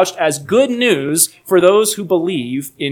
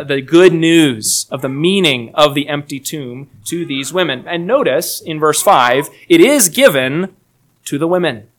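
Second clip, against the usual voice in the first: normal talking voice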